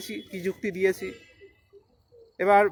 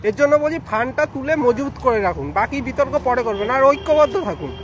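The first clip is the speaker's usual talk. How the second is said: raised voice; 4 semitones higher, 4 dB louder than usual. Real, not acted. Urgent appeal for unity to followers.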